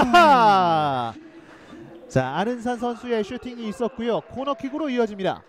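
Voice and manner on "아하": long, slow, loud aha; drawn-out aha. Real, not acted. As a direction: stretch the disappointed aha. Reaction to near miss.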